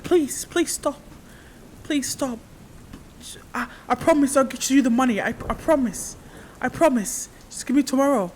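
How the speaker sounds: high, frantic pleading; desperate begging. Acted. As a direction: beg for time, terrified. Cornered boy begging bully.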